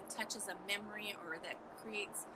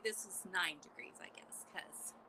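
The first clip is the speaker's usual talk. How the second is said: a soft unvoiced aside, almost to herself; whispered aside; 3 semitones lower, 12 dB quieter than usual. Real, not acted. Vlogger quietly correcting the temperature to viewers, whispered aside. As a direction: whisper the correction under your breath.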